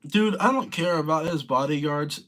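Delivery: usual speaking voice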